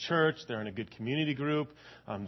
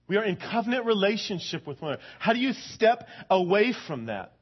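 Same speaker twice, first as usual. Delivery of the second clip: impassioned preaching; 6 semitones higher, 4 dB louder than usual. Acted. Preaching on covenant relationship.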